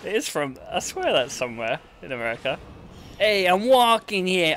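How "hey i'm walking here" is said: put-on high, drawn-out Boston accent. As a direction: call it out slowly in a Boston accent. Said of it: "Oh, Boston, that's it".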